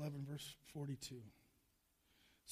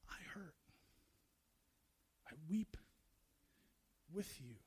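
very soft, lower and halting; hushed, consoling confession; tender compassion; 3 semitones lower, 10 dB quieter than usual. Acted. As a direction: share the pain quietly, with long pauses. God sharing the hurt.

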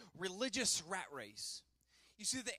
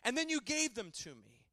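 solemn reading of scripture; 7 dB louder than usual. Acted. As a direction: read it as a fervent prayer.

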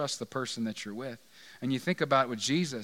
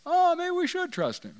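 disciples' voice; 11 semitones higher than usual. Retold or imitated. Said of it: "And then they say".

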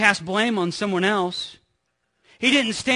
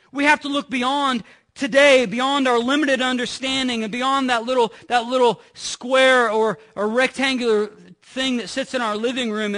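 raised, driving sermon voice; preaching; 4 semitones higher than usual. Acted. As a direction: preach it out to the congregation. Preaching at full force.

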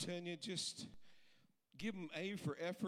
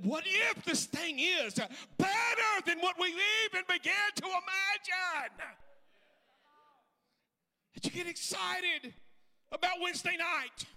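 loud, high, rising to a chanted shout; full-force preaching; 9 semitones higher, 7 dB louder than usual. Acted. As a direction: build the congregation up to a roar. Preaching climax.

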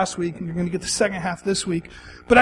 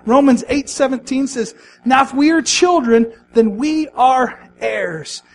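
rising, louder preacher fervour; preaching; 3 semitones higher, 3 dB louder than usual. Acted. Pastor stirs the congregation, fervent preaching. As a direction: build the sermon up with passion.

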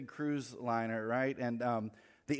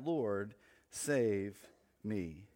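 ordinary delivery

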